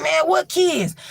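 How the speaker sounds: high, fast bravado; tough scoffing. Retold or imitated. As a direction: scoff like nothing scares you. Dre scoffing at the kids.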